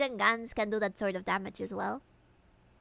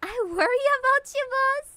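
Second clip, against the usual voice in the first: high put-on voice; 12 semitones higher, 6 dB louder than usual. Voicing Harry's partner Kim.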